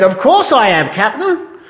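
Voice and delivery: lightly played fib to the captain; lying pirate. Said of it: "Paul lied".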